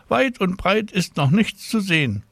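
high voiced caller on the telephone; a nurse calling a child with news, high and friendly; woman on the phone. A narrator's reading. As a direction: lift the voice high for the stranger on the line.

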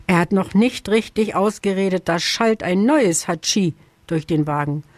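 usual speaking voice

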